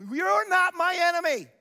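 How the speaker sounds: loud, high, slowing at the end; declared shout. Retold or imitated. Pastor voicing Paul to the congregation, in a ringing shout. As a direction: proclaim it to the whole room. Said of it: "Paul helped us understand".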